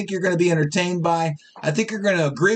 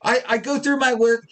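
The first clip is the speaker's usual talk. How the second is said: ordinary talk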